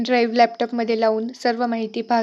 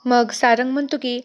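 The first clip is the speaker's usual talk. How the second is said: ordinary delivery, nothing marked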